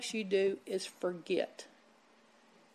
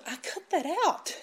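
laughing confession; 4 semitones higher, 5 dB quieter than usual. Retold or imitated. Mother's funny confession.

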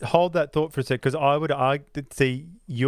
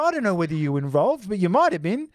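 mock accusing child; 7 semitones higher than usual. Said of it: "and you go".

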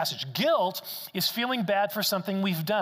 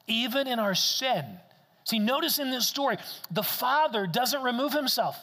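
preaching at full force; 7 semitones higher than usual. Acted. Pastor urging the congregation about the Father's heart, impassioned. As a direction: press the point home to the congregation.

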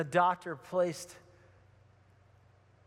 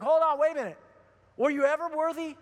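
raised, incredulous interruption; emphatic objection; 6 semitones higher, 4 dB louder than usual. Retold or imitated. Father cutting off his son's apology, emphatic objection. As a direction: stop the confession with a loud challenge.

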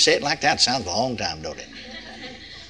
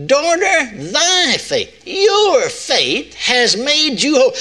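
high, slow emphatic verse; scripture quote; 9 semitones higher, much slower than usual. Acted. Preacher repeating the verse to the church, slow and emphatic. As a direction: lean on "your" and stretch it out.

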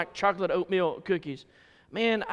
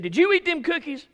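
high, louder mother's demand; stern question; 10 semitones higher, 5 dB louder than usual. Retold or imitated. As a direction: press him for the truth. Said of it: "She said".

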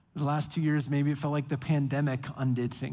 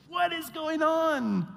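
much higher, slow, stretched-out question; dismayed disbelief; 10 semitones higher, slower than usual. Retold or imitated. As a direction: stare around in mock dismay. Nehemiah returns to find disorder.

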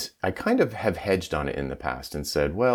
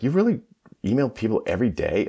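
incredulous; 6 semitones higher, 3 dB louder, faster than usual. Retold or imitated. Host amazed by daily emails.

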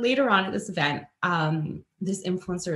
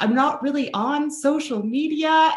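high, slow, sheepish reply; a hint of a helpless rep; imitating rep; 9 semitones higher, 4 dB louder than usual. Brand rep answering the influencer, high and sheepish.

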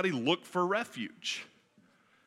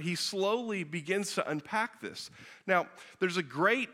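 ordinary delivery

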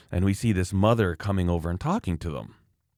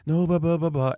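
higher-pitched mock blathering; worked-up mother; 8 semitones higher than usual. Mother getting into the argument, mocking imitation. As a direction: mimic her rattling on dismissively. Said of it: "she started really getting into it".

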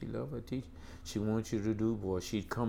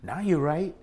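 mock holler; 6 semitones higher, 5 dB louder than usual. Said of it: "Albert Foucault would get up there".

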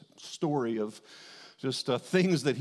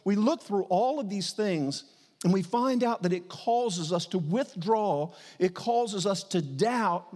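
raised-pitch, emphatic sermon delivery; impassioned preaching; 5 semitones higher than usual. Real, not acted. Preacher tells congregation God loves them, impassioned preaching.

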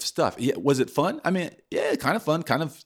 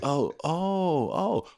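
retold realization, pitch climbing with each oh; dawning realization; 6 semitones higher, much slower than usual. Retelling the moment the trick starts working, amazed. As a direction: build from hopeful to amazed, rising higher. Acting out sudden success dawning.

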